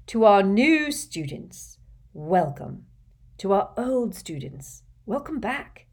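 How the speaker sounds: stately public welcome with swelling opening phrases; headmaster's address. Acted. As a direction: address a whole hall, measured and ceremonious. Voices headmaster addressing the hall.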